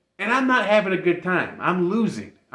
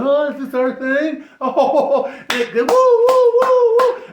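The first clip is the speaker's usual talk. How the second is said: loud, high hammed-up drag queen whooping; mock dramatic entrance; 8 semitones higher, 9 dB louder, slower than usual. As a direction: mimic a group sweeping in with laughing whoops. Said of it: "they came in all dramatic and whatnot".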